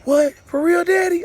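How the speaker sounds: put-on high, thrilled kid's disbelief; excited kids. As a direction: squeal it like an excited little boy. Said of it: "They was like".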